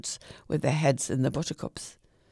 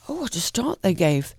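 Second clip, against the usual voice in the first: ordinary delivery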